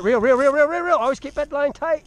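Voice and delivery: rising urgent calls to reel; urgent calling. Real, not acted. Urging kid to reel fish in.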